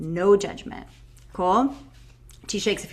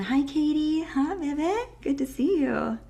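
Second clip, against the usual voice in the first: warm greeting; 6 semitones higher, 3 dB quieter than usual. Real, not acted. Greeting arrivals warmly.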